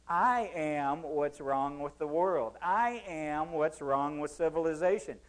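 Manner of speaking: slow, weighty I punched first; I am said slow and pointed; stress on I. Shown as a demonstration. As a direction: lean hard on I, slowly. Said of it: "repeats quote slowly for emphasis".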